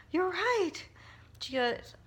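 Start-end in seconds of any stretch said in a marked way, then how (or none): 0.1-0.8 much higher, a retold tearful break; choked-up agreement. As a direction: let it catch in a high cry. Retelling crying while agreeing.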